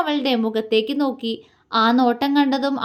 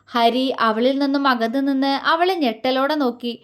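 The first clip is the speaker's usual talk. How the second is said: ordinary delivery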